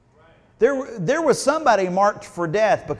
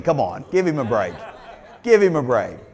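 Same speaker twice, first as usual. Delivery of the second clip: laughing; 6 semitones lower, 3 dB louder than usual. Real, not acted. Laughing at own slip-up.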